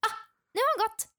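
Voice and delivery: high and fast, a small relieved gasp of words; a four-year-old pleased the coast is clear; relieved little exclamation. Retold or imitated. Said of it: "tittar jag bara". (lättad, hög och snabb utropsröst)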